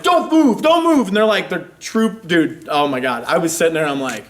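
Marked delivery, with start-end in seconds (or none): 0.0-1.1 cops yelling. Retold or imitated.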